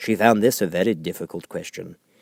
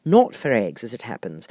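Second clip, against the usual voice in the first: put-on a bit higher girlish voice, explaining; a truthful child reasoning; lightly high girl voice; lightly voiced little girl; 10 semitones higher, 6 dB louder than usual. A narrator's reading. Alice replies to pigeon.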